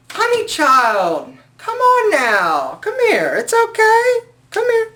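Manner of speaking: put-on high, slow, coaxing "honey child" voice; sassy comforting. Soothing the angry commenter in high sassy voice. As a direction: coo at her like a sassy auntie.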